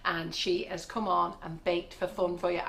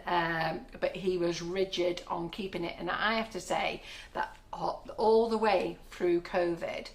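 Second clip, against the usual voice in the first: ordinary talk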